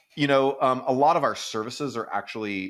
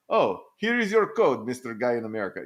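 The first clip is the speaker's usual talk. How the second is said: bad Romanian accent; 3 semitones higher, 3 dB louder than usual. Coder handing work to an American client, bad Romanian accent. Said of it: "That's not a good Romanian accent".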